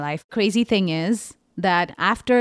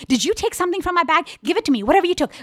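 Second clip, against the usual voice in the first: high, fast accusing demand; imitating angry demand; 10 semitones higher, much faster than usual. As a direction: fire it at her, high and quick. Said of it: "she's like".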